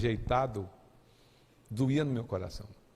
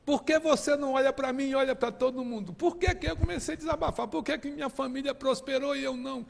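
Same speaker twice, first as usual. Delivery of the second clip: much higher, raised voice crying out to heaven; desperate high-pitched outcry; retold yelling at God; 12 semitones higher than usual. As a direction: shout your complaint up at the sky. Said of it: "começava a gritar".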